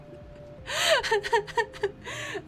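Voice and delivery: high-pitched giggle; high laughing fit. Real, not acted. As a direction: break into giggles.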